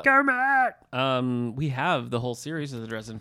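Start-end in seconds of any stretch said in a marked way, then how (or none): 0.0-0.7 high, loud Kermit the Frog shout. Pretend. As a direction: squawk it like Kermit. Muppet voice joke after Fozzie.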